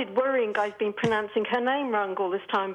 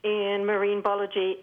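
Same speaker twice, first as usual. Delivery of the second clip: normal talking voice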